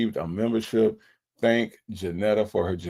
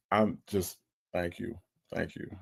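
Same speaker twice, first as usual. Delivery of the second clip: very soft, lower thanks; overwhelmed gratitude; 4 semitones lower, 9 dB quieter than usual. Real, not acted. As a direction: let the voice drop away, humbled. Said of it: "I'm overwhelmed".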